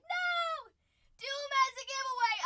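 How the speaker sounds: high, loud horrified cry; shocked shout. Retold or imitated. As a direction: yell it in mock horror. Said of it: "A Price is like".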